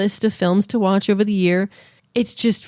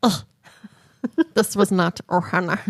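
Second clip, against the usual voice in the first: Stitch voice. Pretend. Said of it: "As Stitch would say".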